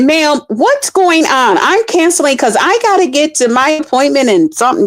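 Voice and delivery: fed up, about to cancel; annoyed passenger. Retold or imitated. Passenger calling the driver, high and irritated. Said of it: "she said".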